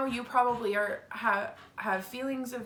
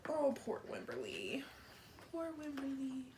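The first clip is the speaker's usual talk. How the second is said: much softer pitying aside; sympathetic; 4 semitones higher, 10 dB quieter than usual. Real, not acted. Reader pitying Wimberly to the children, soft and tender. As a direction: say it softly with pity.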